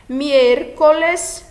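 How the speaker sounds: "Miércoles" slow and loud, stress on "mié"; teacher sounds out "Miércoles" syllable by syllable; Spanish word said out. Shown as a demonstration. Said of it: "teacher models Spanish word". (pronúncia lenta e forte de "Miércoles")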